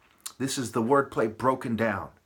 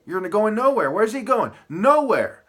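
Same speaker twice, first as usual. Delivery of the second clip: higher and louder, hammering the point; raised mocking emphasis; emphatic; 7 semitones higher, 6 dB louder than usual. Real, not acted. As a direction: punch out nowhere with scorn. Emphatic rant punchline.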